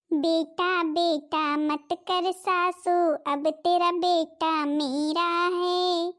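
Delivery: drawn out, each word dragged to mock; slow mocking taunt. Bride slowly taunts her mother-in-law, mocking. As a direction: savour every word to needle her.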